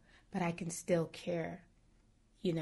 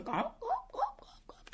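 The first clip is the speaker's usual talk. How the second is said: high whispered clucking gawks; turkey hen call; 6 dB quieter than usual. Retold or imitated. Teacher imitates the mother turkey calling her chicks, high whispered gawks. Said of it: "the poor turkey mother was going".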